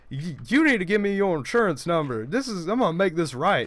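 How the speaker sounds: lightly voiced insistent man on the phone; imitated angry caller. Retelling the stranger demanding insurance details, imitating the angry caller. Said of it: "trying to reenact the gentleman's voice".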